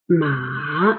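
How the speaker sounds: ma long, sinking then climbing; dipping third tone. Shown as a demonstration. Teacher repeating the third tone, dip and rise. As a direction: drag ma down low and lift it back. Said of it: "lower it and rise it".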